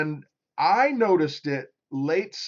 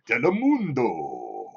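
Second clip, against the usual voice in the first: slow, low Spanish station-ident voice; low Spanish announcer; 4 semitones lower, slower than usual. Acted. Showing chat how the channel says it, low Spanish announcer. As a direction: stretch it out deep in a Spanish accent. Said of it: "you gotta get low".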